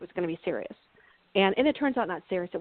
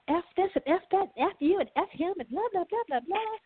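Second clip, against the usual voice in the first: mock cursing; 9 semitones higher than usual. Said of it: "I was".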